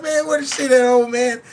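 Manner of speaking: high, loud mock movie voice; hammed-up quote; movie line impression. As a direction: shout it out like the film scene. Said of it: "He said".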